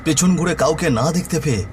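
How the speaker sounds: usual speaking voice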